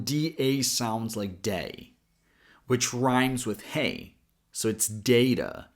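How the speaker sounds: "data" with DA as day, rhymes with hay. Shown as a demonstration. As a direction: open "data" with a long ay. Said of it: "Version one".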